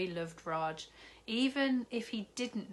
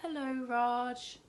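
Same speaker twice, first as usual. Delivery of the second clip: sheepish; 7 semitones higher, 4 dB louder, slower than usual. Acted. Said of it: "said Zoe sheepishly".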